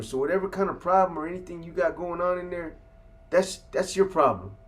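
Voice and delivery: mock angry guard calling his bluff; indignant guard. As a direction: throw the threat right back at him. Guard snapping back at the inmate.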